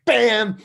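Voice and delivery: mock crash noise. Guitar smashed on the studio floor, loud mock bam. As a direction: slam the word down like a guitar hitting the floor.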